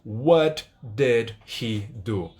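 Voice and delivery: "What did he do?" very slow, each word apart; textbook English. Shown as a demonstration. Teacher spelling out the example, very slow, word by word. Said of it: "de forma lenta".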